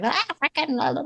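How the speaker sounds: mocking daughter. Mother mimicking her daughter's dismissive retort, mock whine. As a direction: toss it off like a sulky kid brushing mum away.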